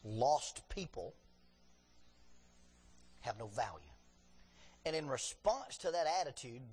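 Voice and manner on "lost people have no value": slow emphasis. Real, not acted. Slow emphatic sermon point.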